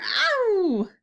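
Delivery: loud, high howl of "ow"; high roaring yelp of a giant; giant's roar. A narrator's reading. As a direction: howl out a long pained cry. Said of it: "roared the flesh lump eater".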